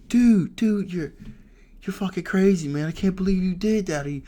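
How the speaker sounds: high, loud, frantic wailing imitation; mock crying panic. Storyteller imitating Snake crying over the reckless chase, high hysterical panic. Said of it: "he's crying. He's crying".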